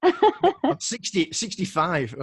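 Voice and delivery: high laughing sixty, stretched out; real laugh on the number; laughing joke. Real, not acted. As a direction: giggle through the joke about age. Joking about his age.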